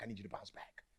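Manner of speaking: soft whispered plea. Real, not acted. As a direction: drop to a hushed whisper for emphasis. Preacher drops to whisper.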